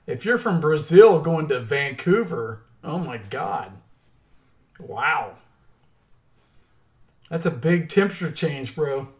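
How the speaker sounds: ordinary talk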